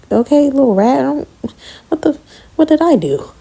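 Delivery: high pitched confused indignation; baffled outrage. Retold or imitated. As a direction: squeak it out in disbelief. Speaker quoting herself baffled.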